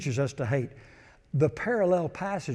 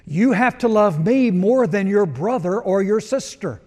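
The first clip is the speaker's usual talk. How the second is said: full-force preaching; 4 semitones higher, 7 dB louder than usual. Acted. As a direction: preach it with rising fervor.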